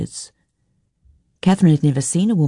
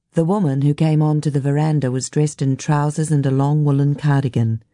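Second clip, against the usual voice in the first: ordinary delivery, nothing marked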